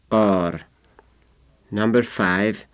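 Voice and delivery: ordinary talk, nothing marked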